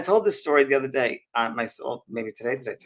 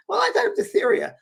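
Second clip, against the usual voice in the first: very high child impression; little girl; 4 dB louder than usual. Little girl repeats her answer to Mary, high child voice. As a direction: insist in a piping little-girl voice. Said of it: "And the little girl goes".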